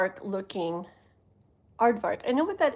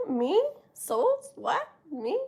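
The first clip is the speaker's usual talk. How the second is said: high, puzzled double take; real surprise, pitched way up; baffled; 8 semitones higher, slower than usual. Real, not acted. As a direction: squeak out in confusion. Baffled by a viewer's comment.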